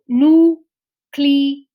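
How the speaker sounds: núcleo slowly broken into separate loud beats; syllable by syllable. Shown as a demonstration. Teacher showing how she divides the word, syllable by syllable. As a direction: sound out núcleo with gaps, drawing out each syllable. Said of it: "la silibación de esa palabra".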